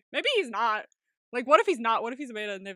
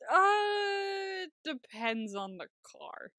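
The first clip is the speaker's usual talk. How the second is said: long high drawn-out "uh", then slow; playful, drawn-out hesitation; hedging answer; 9 semitones higher, much slower than usual. Real, not acted. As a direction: stall on a high note, then answer slowly. Hesitant answer to cheeky question.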